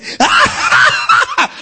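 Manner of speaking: very high rapid cackle; forced high-pitched laughter; demonstrated laugh. Shown as a demonstration. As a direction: fire off a shrieking string of ha-has. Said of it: "I say".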